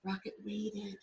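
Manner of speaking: ordinary delivery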